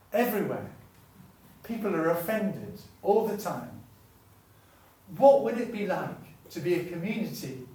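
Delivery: loud, high, rising passion; fervent preacher lifting the crowd; impassioned preaching. Real, not acted. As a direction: build the appeal to a ringing peak.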